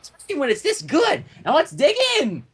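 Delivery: high, gushing and giddy; excited Pinkie Pie gushing; bubbly excited pony. Acted. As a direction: squeal it like a kid on sugar. Pinkie voiced.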